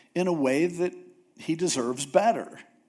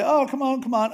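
put-on high, wheedling kid begging; like a little kid; 8 semitones higher than usual. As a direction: whine like a child who wants something. Moses begging God again.

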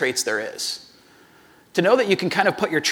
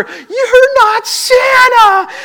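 very slow, high, loud wail; all-out imitation of a screaming boy; child's full scream; 10 dB louder, much slower than usual. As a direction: stretch it into a bawling kid's shriek. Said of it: "he screams it louder than ever".

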